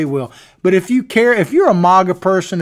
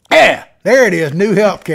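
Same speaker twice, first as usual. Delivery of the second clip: ordinary talk, nothing marked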